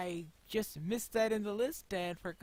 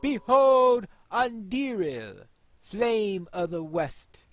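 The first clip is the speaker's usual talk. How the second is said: loud, slow ringing proclamation; proclaiming; 4 semitones higher, 12 dB louder, much slower than usual. Acted. Player voicing the hero raising Anduril, loud proclamation. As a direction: cry it out to the whole hall. Climactic sword presentation.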